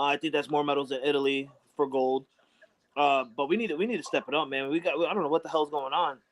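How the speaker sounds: ordinary talk, nothing marked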